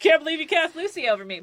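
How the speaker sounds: high, loud, quick mock complaint; hammed-up jealous griping; mock outrage. Repeats the mock sore-loser complaint.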